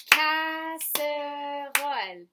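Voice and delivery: casserole drawn out very slowly and loudly in three beats; syllable by syllable. Shown as a demonstration. Teacher counting syllables with the class, casserole very slow, syllable by syllable. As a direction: stretch each syllable: ca, sse, role. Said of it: "Et on compte".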